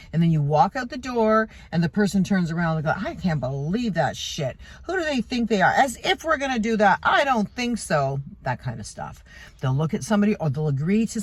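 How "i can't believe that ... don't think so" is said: higher-pitched mock indignation; indignant griping. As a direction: scoff in disbelief as a two-faced colleague. Acts out griping after a meeting.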